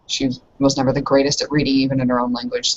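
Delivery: ordinary delivery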